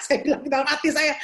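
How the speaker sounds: normal talking voice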